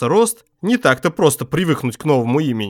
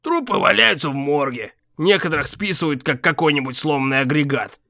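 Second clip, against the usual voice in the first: grumbling; 4 semitones higher than usual. A narrator's reading. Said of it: "пробурчал он".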